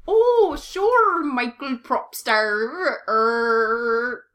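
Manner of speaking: slow, exaggerated American accent with drawn-out Rs; hammed-up American accent, stretching each final ER. Shown as a demonstration. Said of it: "could you do a little American accent".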